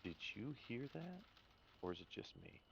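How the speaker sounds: very soft, wary question; hushed Elsa. Acted. Elsa asks Mordecai about a muffled sound, hushed. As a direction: ask it almost under the breath. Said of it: "Elsa looks at you, and she's like".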